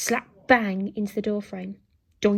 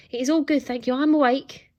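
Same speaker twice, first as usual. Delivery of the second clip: raised, insistent voice; shouting; 4 semitones higher, 7 dB louder than usual. A narrator's reading. Boy insists to robot that he is up, raised voice. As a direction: call out to make it stop. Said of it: "shouted Alfred".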